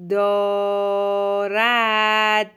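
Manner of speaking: دارد hugely stretched in two syllables; slow dictation. Shown as a demonstration. Teacher dictates for a pupil writing, very drawn-out. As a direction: hold each vowel of دارد on a level pitch.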